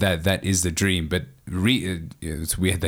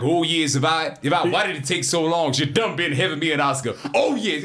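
high, excited Eddie Murphy patter; Eddie Murphy impression; 9 semitones higher than usual. Listing his impressions, high Eddie Murphy riff. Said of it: "Eddie Murphy, like".

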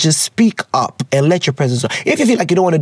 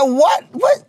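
sharp, much higher incredulous double question; stunned disbelief; 10 semitones higher than usual. Retold or imitated. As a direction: react as if you misheard something absurd. Hearing the name Alfa Romeo.

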